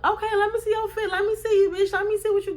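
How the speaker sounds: high, quick hype-mom voice; hyped-up mom. Retold or imitated. Mom on the phone hyping her daughter's outfit, high and quick.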